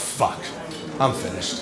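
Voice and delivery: low, quiet, defeated; deflated low mutter. Retold or imitated. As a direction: say it like you've just given up. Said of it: "I said to myself".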